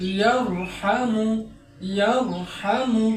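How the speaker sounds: ইয়ারহামু slowly, with a thick র before হামু; heavy ra. Shown as a demonstration. Teacher models ইয়ারহামু slowly with heavy র. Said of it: "মোটা করে পড়তে হয়".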